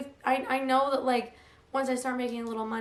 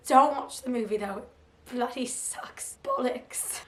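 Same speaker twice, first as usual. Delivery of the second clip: mock English accent. Vlogger reading her book picks to viewers in a put-on English accent. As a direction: ham up a posh English accent. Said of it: "speaking with an English accent".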